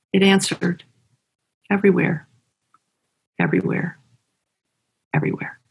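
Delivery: solemn reading of a short poem. Acted. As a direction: read the poem with weight and pauses. Reads the poem again.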